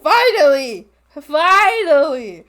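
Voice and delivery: loud, high relieved cry; relieved cheer. Real, not acted. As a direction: drag out the relief. Celebrating the account switch.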